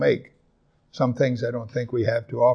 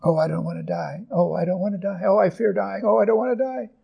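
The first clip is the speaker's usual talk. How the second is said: mock fearful whine; 7 semitones higher than usual. Preacher mimicking a congregant afraid of dying, mock fearful. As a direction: pitch climbing higher with each hammed-up plea. Imitating people who fear death.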